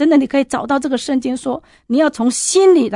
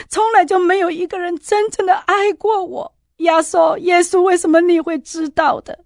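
mother's anguished cry; 7 semitones higher than usual. Retold or imitated. Mother crying out to Jesus.

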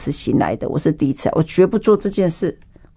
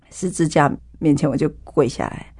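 ordinary talk

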